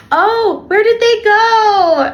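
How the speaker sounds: high, slow, hammed-up pretend puzzlement; mock searching. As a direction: play dumb for the hiding kids. Pretending not to see hiding kids.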